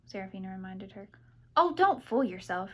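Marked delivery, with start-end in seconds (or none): none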